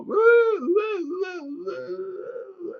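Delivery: high wailing monster cries, trailing away. Retold or imitated. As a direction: moan like crazed inmates crawling on the floor. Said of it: "like monsters".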